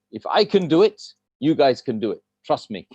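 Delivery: ordinary talk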